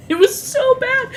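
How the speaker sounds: very high squeaky outburst; incredulous squeal. Real, not acted. Streamer on the awful trailer, high incredulous squeal. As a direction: squeal it through amused disbelief.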